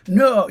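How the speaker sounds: No said high and loud on the first run; wrong reading. Retold or imitated. Actor recalling his first misread take: high No. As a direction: pitch No up sharply. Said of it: "not the way it should have been said".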